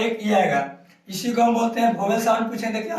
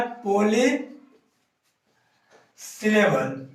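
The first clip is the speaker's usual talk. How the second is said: पॉलीसिलेबल in two parts, long pause after poly; slow dictation; much slower than usual. Shown as a demonstration. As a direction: say poly, wait, then syllable slowly. Teacher spelling out the term slowly.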